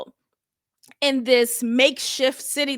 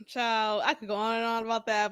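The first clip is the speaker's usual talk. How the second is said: usual speaking voice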